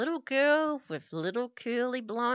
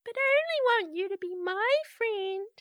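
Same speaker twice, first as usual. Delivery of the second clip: put-on creepy little girl=85; 12 semitones higher than usual.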